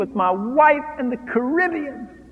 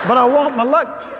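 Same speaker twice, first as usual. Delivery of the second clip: louder, higher, laughing demand for the luggage; mock angry demand; 6 semitones higher, 5 dB louder than usual. Husband demanding luggage from ship's bursar, loud mock anger. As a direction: bang the table, half joking.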